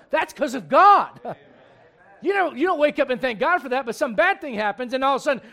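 loud, high, driving preacher voice; fiery preaching. Acted. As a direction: preach it hard at the congregation. Preaching fired up at the unbeliever.

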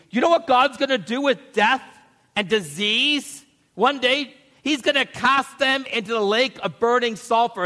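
high, loud, rising preacher's cry; preaching at full force. Acted. Pastor proclaiming victory over death to the church, in full-force preaching. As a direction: proclaim it to the congregation like good news.

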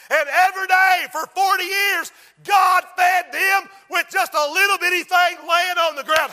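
high, loud, shouted preaching; full-force preaching. Real, not acted. Preacher shouting to the congregation that God provides.

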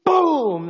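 loud high mock punch noise with a sob; slap sound effect. Preacher imitating the second smack, loud and sharp. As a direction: blurt the impact sound. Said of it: "imitated smack again".

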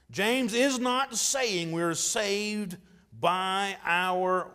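slow, deliberate, hitting each not; emphatic denial. Real, not acted. Pastor insisting James teaches no works salvation, slow and emphatic. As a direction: spell it out word by word for emphasis. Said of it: "I would say with emphatic no".

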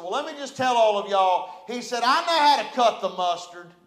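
loud, cocky boast to the congregation; swaggering leader showing off to the church; boastful brag. Retold or imitated. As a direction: puff up and brag like you're above them all. Preacher voices arrogant church leader.

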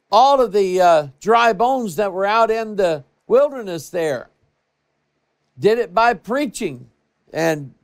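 high, loud preacher's climax; preaching at full force. Acted. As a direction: build it up to a rousing peak. Preaching crescendo.

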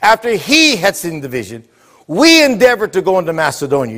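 "he" and "we" each lifted above the rest; stress on "he" and "we". Shown as a demonstration. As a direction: contrast "he" with "we" by punching both. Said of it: "Did you see the change?".

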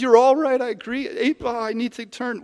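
high, eager mock contrition; lightly acted weepy agreement; repentant Bob. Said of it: "Bob weeps and repents and says".

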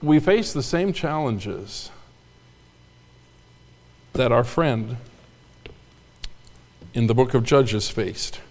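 soft, slow and serious; quiet emphasis. Acted. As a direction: drop it low and quiet. Soft weighty statement.

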